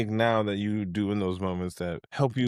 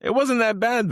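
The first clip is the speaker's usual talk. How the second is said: protesting; 5 dB louder than usual. Real, not acted. Defending the grill, protesting.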